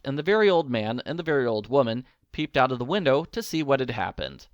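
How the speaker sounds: ordinary delivery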